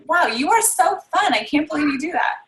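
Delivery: high-pitched pretend amazement; hammed-up, high-pitched admiration; mock gushing.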